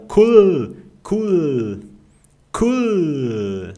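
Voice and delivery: COOL as "kuul", holding a clear final L; long cool, tongue pressed behind front teeth; German L. Shown as a demonstration. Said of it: "você coloca a língua lá no céu da boca". (cool com L alemão prolongado, "kuul")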